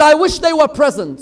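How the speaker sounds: gloating boast. Retold or imitated. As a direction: crow it out loud.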